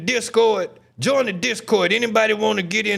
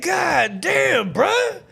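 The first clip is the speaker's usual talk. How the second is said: high, exasperated quote; retold exasperation; 11 semitones higher, much slower than usual. Streamer retelling words after the cop left, exasperated. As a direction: replay your own protest with pitch leaping up. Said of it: "I said".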